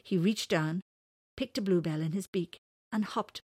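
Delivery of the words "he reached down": usual speaking voice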